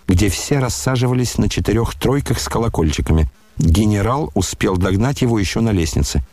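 ordinary delivery, nothing marked

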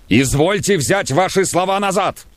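high indignant challenge; indignant demand. A narrator's reading. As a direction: demand a retraction. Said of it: "крикнул Самойленко".

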